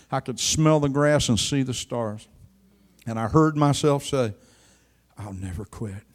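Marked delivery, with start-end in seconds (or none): 5.2-6.0 much lower, soft remembered vow; hushed vow. Retold or imitated. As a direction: say it softly, as if remembering. He hears himself say it, recalled.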